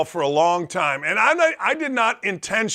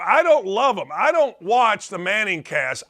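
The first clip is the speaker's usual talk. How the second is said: ordinary delivery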